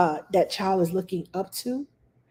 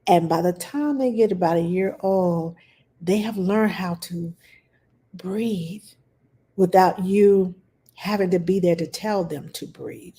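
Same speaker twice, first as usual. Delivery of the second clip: usual speaking voice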